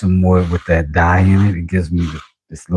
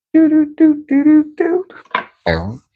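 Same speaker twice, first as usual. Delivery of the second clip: very high, beeping little tune; squeaky sung doo-doo-doo; high "do do do" ditty. Pretend. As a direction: toot out a tiny falsetto melody. Idle nonsense tune while working.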